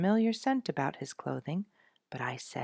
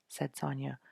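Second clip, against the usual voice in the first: ordinary delivery, nothing marked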